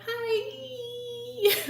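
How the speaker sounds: very high, squeaky little hi with a laugh; cheerful cat greeting the other cat; sweet cat voice. Retold or imitated. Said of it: "my cat was like".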